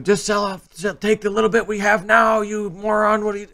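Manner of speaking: high mock screech of a nagging wife; shrill mock wife scolding her husband; screaming wife. Imitating a wife yelling at her husband to sell. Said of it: "Wife screaming at him".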